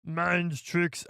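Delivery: lightly put-on alien voice; mild Watto voice; Watto impression. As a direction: try the gravel again. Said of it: "make that gravelly noise".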